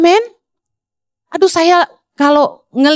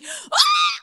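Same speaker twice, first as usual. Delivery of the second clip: very high, thin mock shriek; squealed shout, held back in volume; imitated preaching scream; 8 dB quieter than usual. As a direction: mimic your own high-pitched preaching yell. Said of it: "teriak-teriak itu yang viral".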